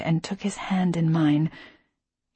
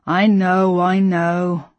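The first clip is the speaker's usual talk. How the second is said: grumbling; 5 dB louder, much slower than usual. A narrator's reading. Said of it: "I grumbled".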